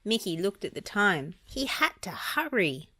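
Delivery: ordinary delivery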